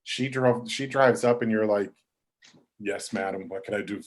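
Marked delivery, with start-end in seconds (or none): 2.8-4.0 soft, lower imitated deference; meekly obliging, acted out; deferential reply. Deferential reply to grand mother.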